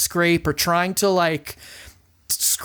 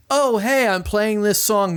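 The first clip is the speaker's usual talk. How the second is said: higher, fake-eager announcement; mock cheerful; 4 semitones higher than usual. Imitating a band faking out listeners, mock cheerful. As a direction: feign innocence in a raised pitch. Acts out band's fake-out opening.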